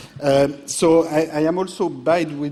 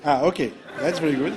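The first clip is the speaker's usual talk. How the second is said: laughing; much faster than usual. Real, not acted. Slides fail to appear.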